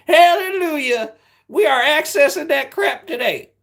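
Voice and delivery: high, loud hammed-up hallelujah; mock jubilation. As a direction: proclaim it in a silly high voice. Hammed-up triumphant outburst.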